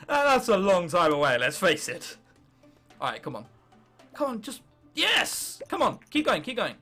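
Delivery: usual speaking voice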